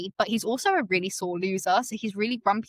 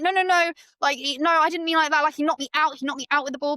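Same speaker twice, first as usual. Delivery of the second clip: imitating a girl frantically backpedalling; flustered panic; 6 semitones higher than usual. Shan panics correcting misunderstanding.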